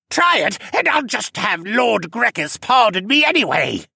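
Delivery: high, loud jeering shout; taunting shout. A narrator's reading. Senna taunting from behind a guard, in a high shout. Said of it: "Senna shouted".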